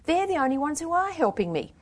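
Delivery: high, defensive plaint; flustered pleading. A narrator's reading. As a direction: plead for those who help you. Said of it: "she stammered".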